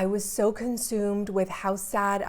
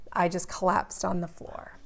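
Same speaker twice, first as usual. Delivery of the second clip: ordinary talk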